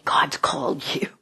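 hushed whisper of assurance; softly whispered encouragement. Retold or imitated. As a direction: breathe it softly like a secret. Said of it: "and I say".

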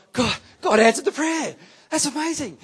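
high, loud, thrilled outburst; surprised excitement. Retold or imitated. Pastor imitates believers stunned by answered prayer, loud and high. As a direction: gush like the answer is a shock. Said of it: "And we get really excited".

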